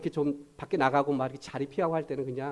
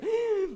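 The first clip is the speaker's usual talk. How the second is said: gasping laugh. Retold or imitated. Laughing till tears.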